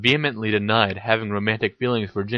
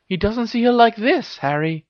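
higher voice with a knowing smile; 12 semitones higher than usual. A narrator's reading. Hermione hints to Harry, knowing. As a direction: answer as if you know more. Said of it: "answered Hermione with a knowing smile".

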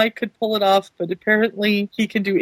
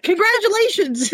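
bright, higher, cheerful; cheery congratulations; 11 semitones higher than usual. Retold or imitated. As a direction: sing out the congrats. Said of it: "'cause I went".

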